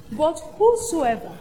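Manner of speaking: "whosoever" slowed and weighted; stress on whosoever. Shown as a demonstration. Pastor pointing the congregation to one word, stressing whosoever. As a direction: lean on "whosoever", drawn out. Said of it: "Listen to that word".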